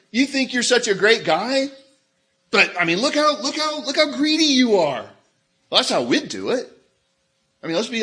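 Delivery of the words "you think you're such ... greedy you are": high, loud, scolding contrast to gentleness; mock rebuke. Preacher acts what Jesus didn't say to the rich man, scolding. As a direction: act out a harsh telling-off, rising higher. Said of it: "Jesus didn't say".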